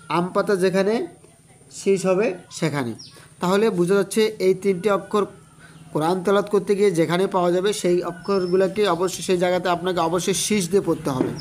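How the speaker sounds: normal talking voice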